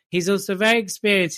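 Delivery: ordinary delivery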